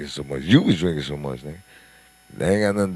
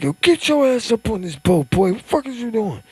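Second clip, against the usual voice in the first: high-pitched retold yell, louder; 5 dB louder than usual. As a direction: imitate an uncle yelling orders, pitch way up. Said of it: "was yelling like".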